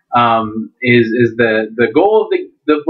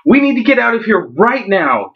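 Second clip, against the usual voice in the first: urgent; 8 semitones higher than usual. Acted. Dougal urges flight from danger.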